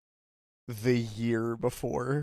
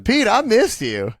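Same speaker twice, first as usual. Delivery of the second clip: high, loud, slow pumped greeting; peppy breathy workout coach; 11 semitones higher, 9 dB louder than usual. Pretend. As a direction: hype him like a trainer. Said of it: "She talks like she's your personal trainer".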